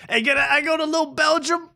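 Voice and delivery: high-pitched parody of a comic's routine; Lil Mo. Retold or imitated. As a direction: pitch it way up and drive to the catchphrase. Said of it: "everything's the same. It's like".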